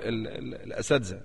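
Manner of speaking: ordinary talk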